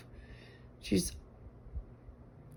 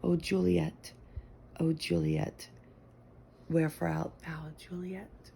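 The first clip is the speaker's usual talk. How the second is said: lightly hammed-up lovesick Romeo, softer; a hint of a swooning Shakespeare lover; mock Romeo; 2 semitones higher, 7 dB quieter, slower than usual. Speaker to her soulmate, a mild mock Romeo plea. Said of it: "the first moment I laid eyes on her".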